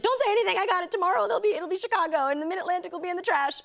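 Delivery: high-pitched, hurried retold pleading; acted high, breakneck assurance; mock urgent promise. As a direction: rush it out high, as if reassuring the director. Retelling her panicked promise to director.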